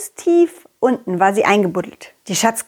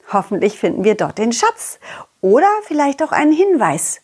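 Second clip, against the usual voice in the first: plain reading voice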